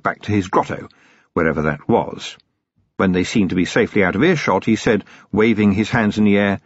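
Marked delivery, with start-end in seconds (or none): none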